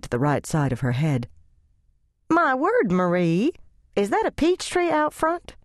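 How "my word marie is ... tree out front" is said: high, fluttery lady voice surprised at the tree; a visiting church lady, high and amazed; gushing church lady. Acted. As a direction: neighbour lady marvelling at the peach tree, high voice.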